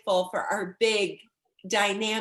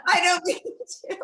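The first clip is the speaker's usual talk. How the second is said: higher, teary laugh in the words; laughing through tears; 8 semitones higher than usual. Real, not acted. Moved guest laughing as she starts to cry. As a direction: laugh while fighting off tears. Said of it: "now I'm gonna start off crying".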